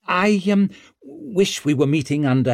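sheepish, uneasy courtesy; awkward, guilty condolence. A narrator's reading. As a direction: stumble politely like a guest at a funeral. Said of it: "trapped between a funeral guest and a boy caught stealing".

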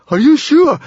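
loud, very high frightened scream. A narrator's reading. Fishlegs doubts the whistling trick, screaming. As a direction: scream the question out. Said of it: "screamed Fishlegs".

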